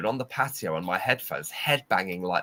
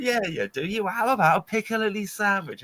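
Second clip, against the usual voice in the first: teasing; 12 semitones higher than usual. Retold or imitated. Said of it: "sweet revenge".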